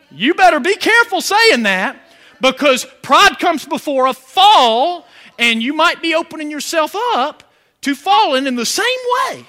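loud, high warning shouted at the pews; preaching shout. Acted. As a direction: raise the voice high and hammer the warning. Preacher warns against pride.

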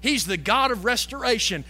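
ordinary talk, nothing marked

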